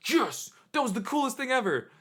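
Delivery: high, gleeful whisper-squeal; giddy high-pitched triumph; thrilled squeal. Retold or imitated. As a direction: celebrate like a fangirl. Walking off after seeing king.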